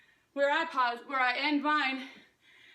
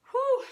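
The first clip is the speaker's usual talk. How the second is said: loud breathy whew, spent; winded exhale; 9 semitones higher, 7 dB louder than usual. Real, not acted. Coach catching breath before the water break, winded whew. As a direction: let the air rush out after the set. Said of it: "I'm completely out of breath".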